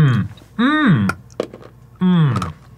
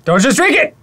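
higher, louder urgent yell; alarmed shout; 12 semitones higher, 4 dB louder than usual. Real, not acted. Warning partner not to drink.

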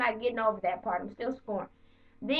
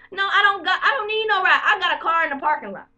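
louder, higher heated no; irritated shout at HR; indignant refusal; 5 semitones higher, 6 dB louder than usual. Retold or imitated. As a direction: snap back, offended. Refusing the ride offer.

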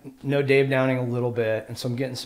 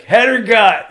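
tough guy threat; 9 semitones higher, 10 dB louder, slower than usual. Retold or imitated. Craig threatening, voiced.

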